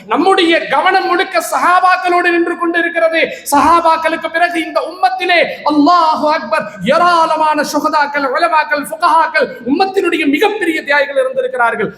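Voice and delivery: high, sustained oratorical voice; impassioned preaching. Real, not acted. Preacher to the congregation on forgotten martyrs, impassioned.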